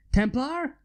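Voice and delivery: tossed-off call of a name, rising; casual call. Acted. Steamlord casually summons his knight, light rising call. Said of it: "the man said casually".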